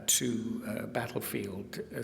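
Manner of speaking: ordinary talk, nothing marked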